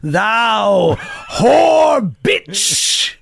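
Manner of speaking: slow, high, shouted fake Shakespeare curses; cursing old actor. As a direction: bellow made-up curses like King Lear. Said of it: "he'd just be like".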